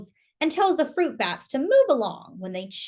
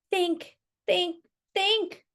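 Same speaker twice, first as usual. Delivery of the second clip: 1 anxious thinking; 8 semitones higher, slower than usual. Acted. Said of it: "thinks Emil".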